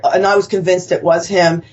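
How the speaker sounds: ordinary delivery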